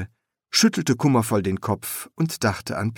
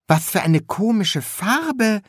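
high, amazed apprentice voice; astonished young apprentice; blurted out; 11 semitones higher than usual. A narrator's reading. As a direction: blurt it out in high-pitched wonder. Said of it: "platzte es aus ihm heraus".

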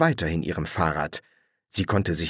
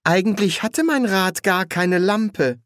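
put-on high-pitched young woman's voice; young woman, uneasy; 10 semitones higher, 3 dB louder than usual. Young witch tells two strangers about her bike, uneasy.

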